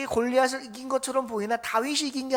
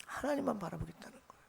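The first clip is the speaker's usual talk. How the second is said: much softer, hushed restatement; soft repeat; 3 semitones lower, 14 dB quieter than usual. Real, not acted. Pastor softly repeating to the congregation, hushed. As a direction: let it sink in quietly.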